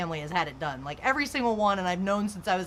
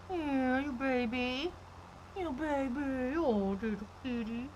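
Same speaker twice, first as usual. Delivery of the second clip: higher, softer cooing at the kitty; 4 semitones higher, 7 dB quieter, slower than usual. Real, not acted. Cooing to her cat.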